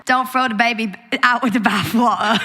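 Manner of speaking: hammed-up British accent. Shown as a demonstration. Guest tries out British for the Australian host, hammed-up British accent. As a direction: put on a shaky attempt at British. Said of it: "I can do British, kind of".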